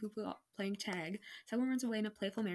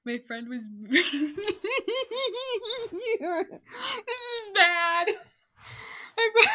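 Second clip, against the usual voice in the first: loud words broken by laughter; laughing; 12 semitones higher, 9 dB louder than usual. Real, not acted. Recalling her old story, loud laughing words.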